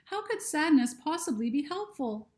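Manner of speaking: Lucy's voice. Acted. Lucy speaks in story.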